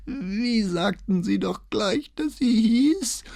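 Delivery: put-on slow, high old man's question; doddering old notary, pitched high; forgetful old man. A narrator's reading. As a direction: drag out the question as if memory fails. Old man feigns forgetting name.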